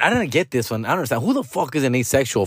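ordinary talk

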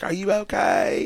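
high, slow, drawn-out check-in; mock concerned Leanne. Leanne checking on him after the attack, concerned. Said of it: "she's like".

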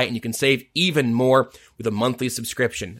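ordinary delivery

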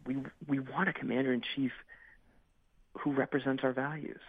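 earnest, pressing political appeal; urgent. Real, not acted. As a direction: press the point with real urgency. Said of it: "can hear the urgency in your voice".

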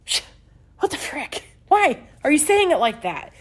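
put-on inner child's outburst. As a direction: squeak it out like a baffled little girl. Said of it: "My inner child was like".

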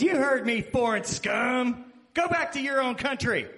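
high, loud hammed-up tirade; mock old-man shout. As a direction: holler like an angry old heckler, drawing out the insult. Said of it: "elderly gentleman in a motorized cart".

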